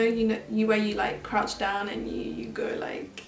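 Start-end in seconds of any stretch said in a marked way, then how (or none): 1.9-3.2 whisper. Real, not acted. Trying to describe dance move.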